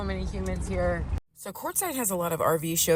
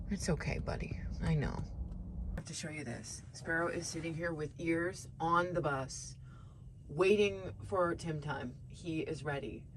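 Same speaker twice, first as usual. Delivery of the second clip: soft, near whispered; hushed; 3 semitones lower, 12 dB quieter than usual. Real, not acted. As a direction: keep it hushed by the dog. Hushed beside waiting dog.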